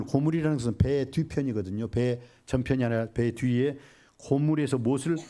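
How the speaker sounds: ordinary talk, nothing marked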